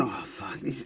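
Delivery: breathy fed-up aside, voice almost gone; muttered under breath. Retold or imitated. Bouncer fed up with the naked drunk, muttered under breath. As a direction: mutter it like you're giving up on him.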